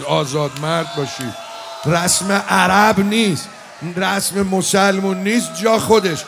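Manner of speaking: loud, slow anguished cry; mourning sermon shouted at full force; full preaching shout. Acted. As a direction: thunder it as a last defiant plea. Hussein's cry to the enemy.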